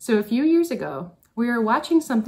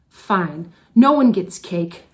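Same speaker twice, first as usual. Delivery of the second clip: slow, louder angry snap; snapping; 7 dB louder, slower than usual. Retold or imitated. Teacher reenacting snapping at her class, slow and louder. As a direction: bite off the words, losing patience. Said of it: "I snapped".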